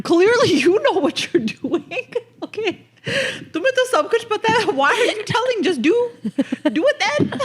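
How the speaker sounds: high, laughing, sarcastic retort; laughing mockery. Real, not acted. Wife mocking her know-it-all husband, laughing. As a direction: laugh it off while mocking him.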